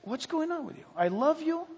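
high, pleading concern; God's loving appeal. Acted. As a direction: plead tenderly with someone who is hurting. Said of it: "and he, and he says".

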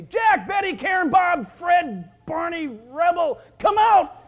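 loud, high roll-call shout; Jesus calling out. Acted. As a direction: bellow out each name to summon them. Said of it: "he's gonna say".

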